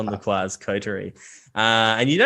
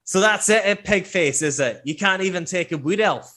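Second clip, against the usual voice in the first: higher, loud taunting challenge; put-on accent, goading and raised; belligerent drunk dwarf; 7 semitones higher, 3 dB louder than usual. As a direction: pick a fight like a drunken brawler. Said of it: "stench of ale on his breath, and he's like".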